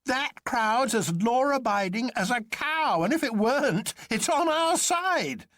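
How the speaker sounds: high jolly doctor, teasing his friends; Bull crying out. A narrator's reading. Bull's voice held in narration.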